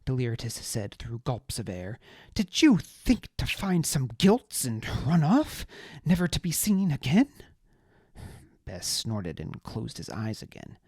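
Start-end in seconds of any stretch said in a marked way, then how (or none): 2.3-7.3 high, loud, outraged aristocrat ranting; pompous little lord, higher and louder, furious; indignant high-voiced noble. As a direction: spit out the betrayal in a high indignant voice. Deliritus voice.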